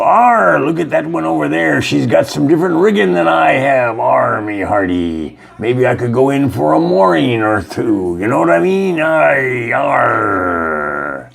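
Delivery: high hammy pirate growl. Retold or imitated. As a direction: play a leering pirate, all "arr". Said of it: "the pirates go".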